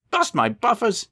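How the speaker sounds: a high, startled cry; shocked after the crash; dazed exclamation. Acted. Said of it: "he exclaimed".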